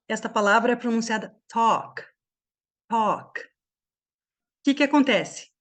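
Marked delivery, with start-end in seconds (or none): 1.5-2.0 "talk" with one open O, no L. Shown as a demonstration. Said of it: "vão ter o som de O". 2.9-3.4 "talk" with one open O, no L. Shown as a demonstration. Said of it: "vão ter o som de O".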